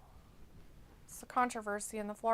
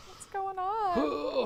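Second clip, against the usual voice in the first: slow, higher, drawn-out question; baffled; 8 semitones higher, much slower than usual. Real, not acted. Streamer baffled by the pet's noise, slow puzzled question. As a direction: stretch it out in disbelief.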